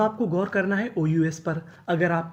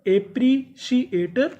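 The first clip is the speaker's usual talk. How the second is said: एप्रिशिएटर stretched slowly, higher with a rise; slow drawn-out model word; 5 semitones higher, 3 dB louder, much slower than usual. Shown as a demonstration. Teacher repeats एप्रिशिएटर slowly for pupils to copy. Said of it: "तो पूरी meaning".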